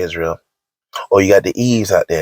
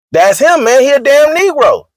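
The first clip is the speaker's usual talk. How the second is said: excited; 5 dB louder than usual. Acted. Mocking laughing outburst.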